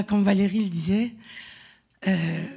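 ordinary talk, nothing marked

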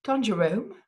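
ordinary delivery

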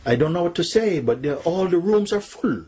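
high-pitched, distressed report to a king; alarmed minister. Acted.

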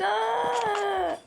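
high, loud sudden gasp; surprised cry. Real, not acted. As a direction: yelp in surprise. Sudden exclamation in build.

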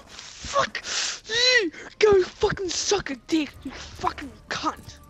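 real gamer rage at the screen; angry insults. Real, not acted. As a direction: spit the insults out in fury. Screaming insults at an enemy player.

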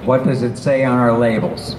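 ordinary talk, nothing marked

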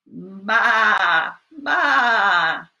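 loud bleating baa; sheep bleat. Shown as a demonstration. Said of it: "what does the sheep say".

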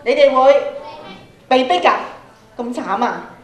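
ordinary delivery

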